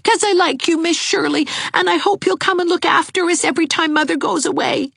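put-on high, fast, eager boy's voice; an excited child blurting it out; cried happily. A narrator's reading. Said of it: "cried Gerald".

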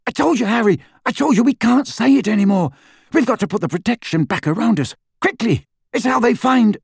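high, urgent alarm; frantic warning. Acted. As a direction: blurt it out in high-pitched panic. Frantic warning as danger arrives.

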